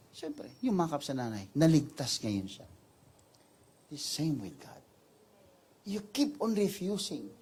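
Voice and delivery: ordinary delivery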